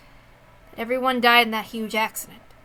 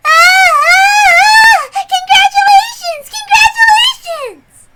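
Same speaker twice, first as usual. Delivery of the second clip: much higher, loud, crying congratulations; sobbing shout; 14 dB louder, much slower than usual. Acted. Crying out congratulations to the couple through sobs. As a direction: wail it through tears of joy. Tearful cheering at the wedding.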